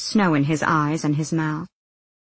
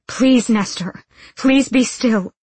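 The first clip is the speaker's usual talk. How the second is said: higher, anxious appeal; rising, louder desperation; urgent pleading; 6 semitones higher, 4 dB louder, slower than usual. A narrator's reading. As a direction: beg him to hold still. Nina tries calming struggling friend.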